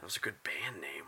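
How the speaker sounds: voiceless whisper. Real, not acted. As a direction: whisper it aside. Whispered aside.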